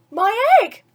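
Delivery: high, loud, thrilled exclamation; excited shout. Acted. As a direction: burst out with delight at the hatching. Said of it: "shouted Horton".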